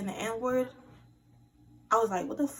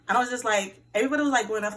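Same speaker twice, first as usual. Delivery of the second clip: louder and higher burst; raised excited voice; 6 semitones higher, 6 dB louder, much faster than usual. Real, not acted. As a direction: surge up excitedly.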